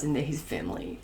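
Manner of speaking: ordinary delivery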